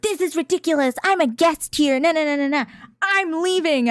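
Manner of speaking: high-pitched mimicry of an offended customer; mock indignant. Vendor protesting she is a guest, mock indignation. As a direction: imitate her huffy protest up high.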